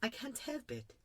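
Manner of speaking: put-on small, high, soft child's voice; embarrassed little prince. A narrator's reading. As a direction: apologise meekly as a little boy. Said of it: "quite embarrassed".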